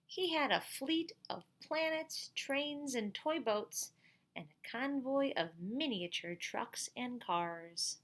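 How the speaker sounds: bright, slow storybook narration; lively storybook voice for kids; reading to children. A narrator's reading. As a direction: read it out to the class like a picture book. Reads picture book to class.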